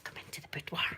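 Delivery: hushed mock come-hither; soft, teasing stage whisper; playful luring whisper. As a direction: whisper it like a pretend temptation. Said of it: "Luring yous in".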